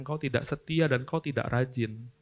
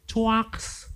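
mock laugh; 7 semitones higher than usual. Mocking laugh sound.

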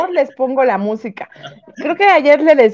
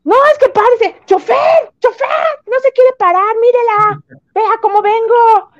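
put-on shrill old lady yelling; 9 semitones higher than usual. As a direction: play a pushy grandma calling out to the bus driver. Grandma yelling to the driver.

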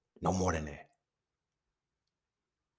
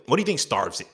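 usual speaking voice

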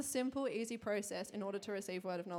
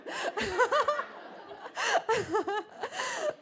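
long squeaky fit of laughter; wheezing laughter, high and long; 12 semitones higher, 4 dB louder than usual. Real, not acted.

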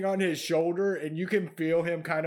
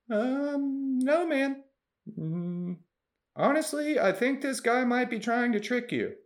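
nervous goblin; 8 semitones higher than usual. Acted. GM voicing goblin denying.

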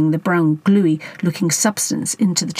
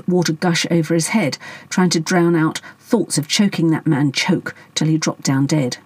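ordinary delivery

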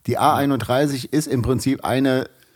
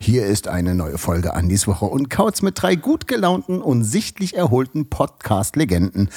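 usual speaking voice

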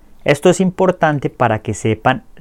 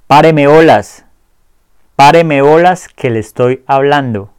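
louder, higher, exasperated demand; fed-up girlfriend; 3 semitones higher, 6 dB louder than usual. Acted. Girlfriend fed up with being ignored, loud exasperated scolding. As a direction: snap at him to pay attention. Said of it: "finalmente ella dice".

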